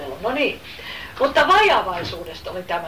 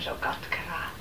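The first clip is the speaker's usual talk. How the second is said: grave whisper; 5 semitones lower, 12 dB quieter than usual. Real, not acted. Preacher reveals the bitter water.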